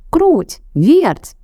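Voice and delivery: high ringing call to the mice; rooster calling out. A narrator's reading. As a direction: call them over, bright and high. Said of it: "позвал петушок".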